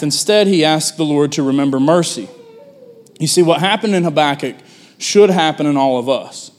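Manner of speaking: ordinary talk, nothing marked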